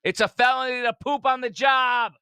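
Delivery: high, loud mocking shout; mock outrage. Mocking the bathroom clip.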